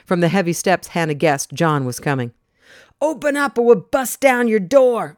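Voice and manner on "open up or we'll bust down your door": higher, louder threatening demand; harsh demand. Acted. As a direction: threaten through the door. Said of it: "another harsh voice demanded".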